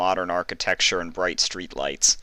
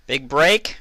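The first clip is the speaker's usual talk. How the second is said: higher, louder echoing call; raised calling voice; 8 semitones higher, 5 dB louder than usual. A narrator's reading. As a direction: call across an empty hall. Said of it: "she called out".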